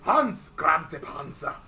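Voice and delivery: put-on slow, loud German-accented order; mock German accent.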